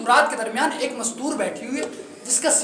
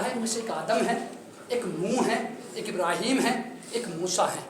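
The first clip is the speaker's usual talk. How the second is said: hushed recital; 4 semitones lower, 11 dB quieter than usual. Acted. Servant's answer in the dream.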